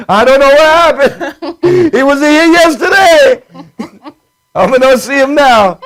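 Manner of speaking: high, innocent-sounding Sicilian relative; Sicilian accent. Acted. Host voices relatives denying a disappearance, Sicilian accent. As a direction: play dumb in a Sicilian accent. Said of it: "That's about the only good accent I can do".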